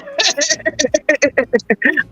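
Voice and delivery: rolling, breath-spending chuckles; helpless run of laughter; real laughing fit. Real, not acted. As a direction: keep giggling at your own story. Laughing over the cold cuts story.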